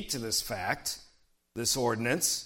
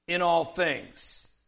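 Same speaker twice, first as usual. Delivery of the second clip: "In all things" slow and deliberate; emphatic repetition; 3 semitones higher, slower than usual. Real, not acted. Preacher repeating the key phrase for the congregation, "In all things" slow and emphatic. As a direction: repeat "In all things" slowly, letting each word land. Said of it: "notice it says here in this passage".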